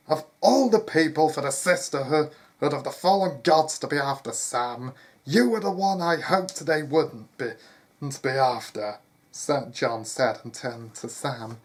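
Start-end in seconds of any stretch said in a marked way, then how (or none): 0.1-9.0 raised, emphatic voice of a worried father; urgent outburst. Acted. Father lamenting to his son who is hunted, urgent outburst. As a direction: exclaim it loudly, with urgency.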